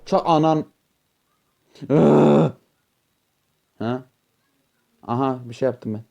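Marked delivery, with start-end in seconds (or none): 1.9-2.5 loud strained groan; frustrated groan. Real, not acted. Player groans loudly at falling again, strained groan. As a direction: groan as the character falls.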